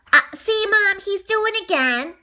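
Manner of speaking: high, loud complaint to Mom; tattling. Acted. Little sister tells Mom on her brother, high tattling. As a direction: whine that brother is at it.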